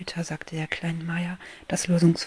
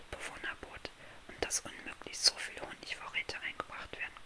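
soft unvoiced bedtime-story whisper; hushed fairy-tale narration; whispered storytelling; 9 dB quieter than usual. A narrator's reading. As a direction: whisper the tale close to the listener.

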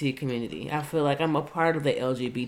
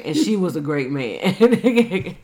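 laughing twist; 7 dB louder, slower than usual. Retold or imitated. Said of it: "And he said".

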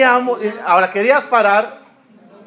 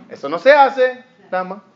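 normal talking voice

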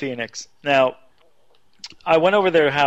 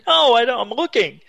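higher-pitched retold reply; acted exasperation, pitched up; flustered protest; 8 semitones higher than usual. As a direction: protest while being hurried along. Flustered reply in retold frantic call.

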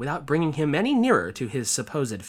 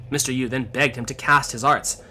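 plain reading voice

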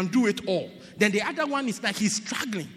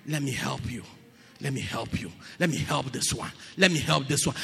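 a gentle God offering help, lower; voicing God; 6 semitones lower than usual. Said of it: "But God decide".

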